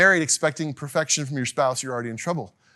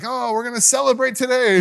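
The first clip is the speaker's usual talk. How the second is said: high, loud party-goer's cheer; joyful celebrant; 8 semitones higher, 5 dB louder than usual. Acted. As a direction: voice a crowd thrilled to celebrate. Voicing joyful crowd at temple.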